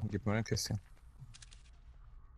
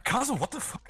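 outraged; 14 semitones higher than usual. Real, not acted. Outraged at teammate.

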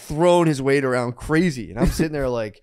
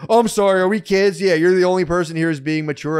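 higher, louder mock apology; retold sarcastic apology; 5 semitones higher, 5 dB louder than usual. Storyteller replaying his dig at the rival, loudly sarcastic. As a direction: lay on the sarcasm, re-enacting a taunt.

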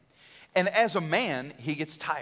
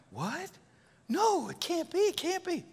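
high-pitched, hammed-up disbelief; shocked disbelief; 12 semitones higher, 4 dB quieter than usual. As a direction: squeal it like stunned disciples in denial. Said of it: "disciples have this reaction like".

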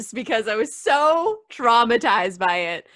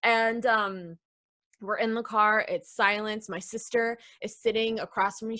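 usual speaking voice

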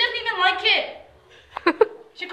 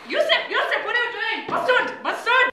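higher, hammed-up scolding mother; Zainab from EastEnders; 5 semitones higher than usual. Mother demands what Yusuf is doing, hammed-up dismay. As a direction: plead with a son in mock dismay. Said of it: "Zainab from EastEnders".

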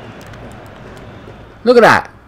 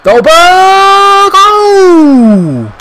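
ecstatic shout; 8 dB louder, much slower than usual. Real, not acted. Gamer screams as his striker scores, ecstatic shout. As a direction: scream the name, much higher and louder.

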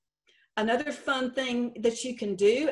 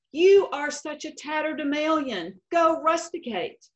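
higher, louder retold put-down; mock insult; 6 semitones higher, 5 dB louder than usual. Speller insulting her rival, mock haughty. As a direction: hurl a showy word at a rival. Reading students' insult exchange.

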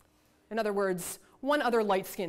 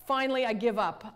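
ordinary talk, nothing marked

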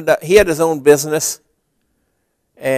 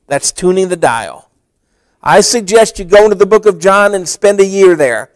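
louder, driving sermon close; full-force preaching; 4 semitones higher, 7 dB louder than usual. Acted. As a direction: press the message home to the congregation. Preaching the closing appeal.

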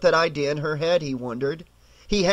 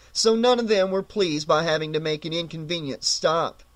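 plain reading voice

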